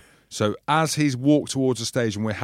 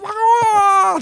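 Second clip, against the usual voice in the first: loud high-pitched cackling laugh; shrieking laugh; high cackle; 9 dB louder than usual. Retold or imitated. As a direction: cackle after the impression. After fan impression.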